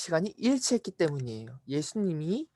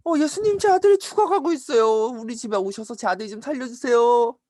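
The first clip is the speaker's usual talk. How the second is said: loud, high-pitched begging; desperate plea; 6 semitones higher, 6 dB louder than usual. Acted. Father begs Jesus to save his dying son, high urgent plea. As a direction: cry out urgently for help, acted.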